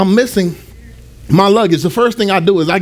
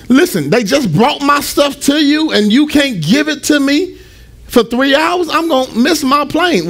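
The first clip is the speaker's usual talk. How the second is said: higher-pitched, exasperated retelling of the plea; 6 semitones higher than usual. Retold or imitated. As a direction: replay your disbelief at the desk, pitched up. Pleading with lost and found clerk.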